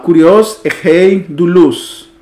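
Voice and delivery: slow, word-by-word Greek reading; example sentence read-out. Shown as a demonstration. Teacher puts up a Greek example for students, slow read-out. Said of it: "Vamos a poner este ejemplo".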